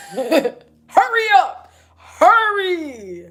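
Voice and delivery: laughing hurry. Retold or imitated. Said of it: "I'm gonna be like".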